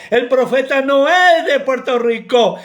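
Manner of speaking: ordinary delivery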